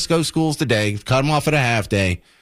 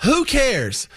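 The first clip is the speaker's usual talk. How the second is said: mock dismissive; 10 semitones higher than usual. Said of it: "correct response would be".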